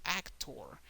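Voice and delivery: "actor" as AC-tor, English style; English "actor", first syllable stressed. Shown as a demonstration. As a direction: punch AC in "actor", let tor fall away. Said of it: "The emphasis is more in the first syllable".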